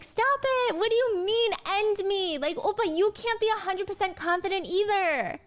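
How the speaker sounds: high, cute aegyo whine; pouty, playful scolding in a sweet high voice; joking angry. Retold or imitated. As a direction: imitate a cutesy mock-angry protest. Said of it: "joking angry".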